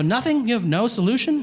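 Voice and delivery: hammed-up shocked customer; mock disbelief. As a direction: act stunned at the clerk. Incredulous at the clerk.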